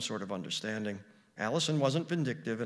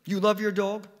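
loud, higher, fierce challenge; fierce question; 9 semitones higher, 11 dB louder than usual. Acted. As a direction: confront her sharply. Allison confronting girl fiercely.

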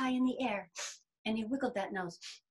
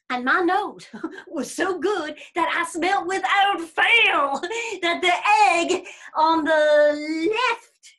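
boastful bear; 3 semitones higher, 7 dB louder than usual. Acted. Bear telling rabbit of a far smell, boastful. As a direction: savour each step of the tall tale.

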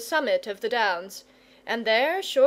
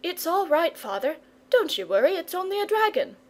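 mildly sleepy, higher-voiced boy; 7 semitones higher, faster than usual. A narrator's reading. Boy to his worried father, mildly sleepy. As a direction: reassure through a touch of drowsiness. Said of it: "said sleepily".

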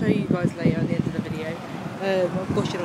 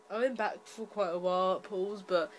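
too worn out to project, much softer; weary, hushed sign-off to viewers; really tired; 12 dB quieter than usual. Real, not acted. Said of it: "I've just been too tired".

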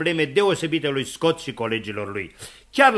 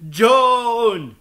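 raised, long call falling at the end; 8 semitones higher, 5 dB louder than usual. Acted. Scott calls his friend in vain, louder calling out. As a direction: shout into the distance for someone. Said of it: "în zadar striga după el".

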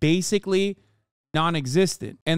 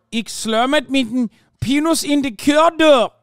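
exaggerated Dutch accent, higher and louder; mock Dutch accent; 9 semitones higher, 6 dB louder than usual. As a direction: ham up a fake Dutch accent. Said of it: "You did a perfect Dutch accent".

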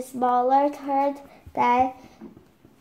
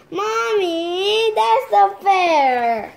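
higher, louder, slow whine at mom; whiny complaint; 7 semitones higher, 7 dB louder, slower than usual. Real, not acted. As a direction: protest it drawn out and louder. Child complains to mother mid-reading.

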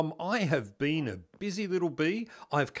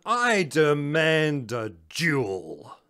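mock angry tirade. Wronged rival demands a duel, hammy angry declaiming. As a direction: declaim it like a theatrical challenge. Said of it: "I'm yelling and shaking my fist in anger".

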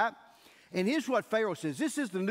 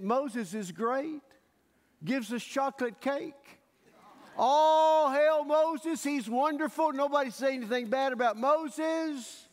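slow, high, hammed-up praise; mock adoration; 5 semitones higher, slower than usual. The fickle crowd fawns over Moses, hammed-up high praise. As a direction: overdo the fawning crowd.

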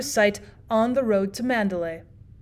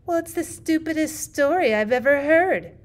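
put-on higher, emphatic scorn from a girl; immense conviction; 6 semitones higher than usual. A narrator's reading. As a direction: declare it with total conviction. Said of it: "with immense conviction".

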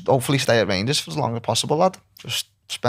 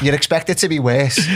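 laughing; 3 semitones higher, 4 dB louder than usual. Real, not acted. Laughs about the Scottish weather.

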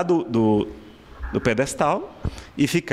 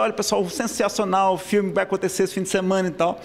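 higher-pitched imitation of a town film announcer; loudspeaker announcer; 5 semitones higher than usual. Cousin announcing the weekend film to the town, imitated announcer voice. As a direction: plug the movie like a small-town announcer. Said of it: "aí tinha a radiodifusora, aqueles alto-falantes".

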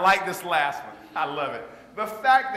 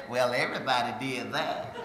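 low, dry put-down; unimpressed and deadpan; dry unimpressed lady; 9 semitones lower, 4 dB quieter than usual. Retold or imitated. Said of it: "I say".

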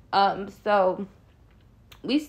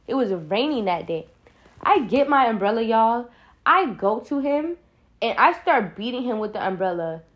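ordinary talk

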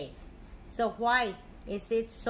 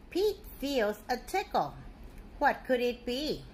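plain reading voice

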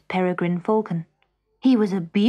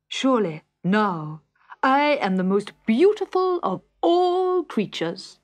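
grand self-praise, swelling higher; vain falcon; 3 semitones higher, 3 dB louder, slower than usual. A narrator's reading. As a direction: preen aloud, stretching the last words high.

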